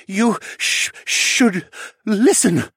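high, halting stutter. A narrator's reading. Captured mage pleads with his captor, stuttering. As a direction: stumble haltingly over the words. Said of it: "the mage stuttered".

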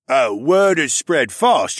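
mocking taunt. A narrator's reading. Polar bear answering His Highness, taunting. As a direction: drag it out tauntingly.

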